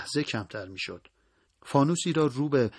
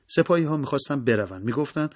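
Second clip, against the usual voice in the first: ordinary delivery, nothing marked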